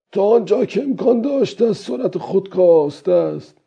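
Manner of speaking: put-on high-pitched old fisherman talking to himself. Fisherman planning how to tie the ropes, old-man voice. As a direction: raise the voice into a musing old man.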